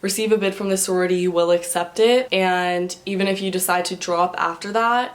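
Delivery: ordinary talk, nothing marked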